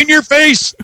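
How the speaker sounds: high, crowing victory jab; playful, high-pitched gloat; gloating triumph. Real, not acted. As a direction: crow it over the loser. Gloats after winning the argument.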